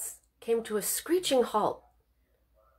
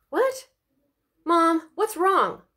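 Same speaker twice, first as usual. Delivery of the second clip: alarmed; 8 semitones higher, 4 dB louder, much slower than usual. Acted. Alarmed girl as mom leaves.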